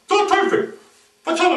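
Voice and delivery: high indignant snake. Acted. Snake annoyed.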